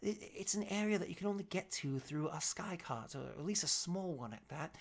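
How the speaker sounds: soft, confidential contact voice; wary informant. Acted. Game master plays informant giving directions.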